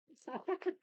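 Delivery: short high laugh; surprised laugh. Real, not acted. Struggling to open a tight package.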